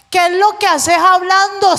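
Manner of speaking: normal talking voice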